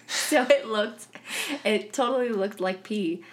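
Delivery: amused laughing delivery; chuckling as she says it; laughing while talking. Real, not acted. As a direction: laugh through the words. Laughing at her own story.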